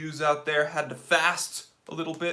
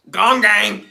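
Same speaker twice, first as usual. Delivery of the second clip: loud, higher gong gang call; catchphrase shout; 4 semitones higher, 12 dB louder than usual. Real, not acted. Streamer hyping his live chat with a loud chant. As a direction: belt out the catchphrase.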